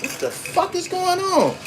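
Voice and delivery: high, stunned outrage; incredulous high-pitched "what the fuck"; shocked bystanders. Retold or imitated. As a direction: squeak it out like a bewildered onlooker. Bystanders react to jaywalking.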